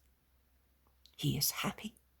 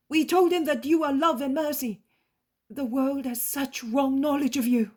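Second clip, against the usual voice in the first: higher, louder earnest reply; eager disciple; 7 semitones higher, 10 dB louder than usual. A narrator's reading. Disciples tell Jesus what they said, earnest. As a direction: answer the master eagerly.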